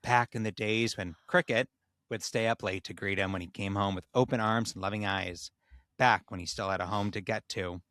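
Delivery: measured storyteller reading; measured storyteller reading a tale aloud; story narration. A narrator's reading.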